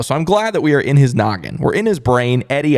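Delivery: ordinary talk, nothing marked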